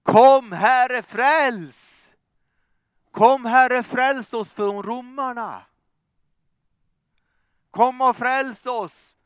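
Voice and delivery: high, loud pleading cries; crowd pleading. Acted. Preacher voicing the crowd crying for rescue, loud and pleading. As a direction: call out for a saviour as the crowd.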